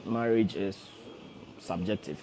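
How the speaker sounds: normal talking voice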